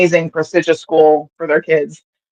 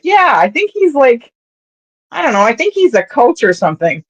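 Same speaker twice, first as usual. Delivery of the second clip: put-on high, childlike voice; lightly voiced young girl; little girl voice; 6 semitones higher than usual. Seven-year-old daughter voiced.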